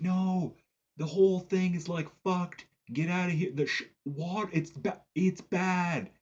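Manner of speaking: high, halting imitation of calling out; mock frantic warning. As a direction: imitate desperately warning people away. Woman warns surfers to leave.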